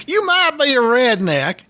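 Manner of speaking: light mock Southern comic punchline; Foxworthy catchphrase. Host tries the comedian's catchphrase, lightly voiced. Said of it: "Jeff Foxworthy".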